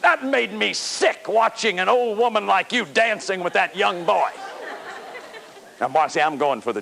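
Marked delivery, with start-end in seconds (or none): 0.0-4.3 slow, sneering, laughing scorn; mock disgusted attack. Shown as a demonstration. Therapist acting a shaming partner, mock contemptuous sneer. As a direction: jeer at her with contempt. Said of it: "here's another way to do it".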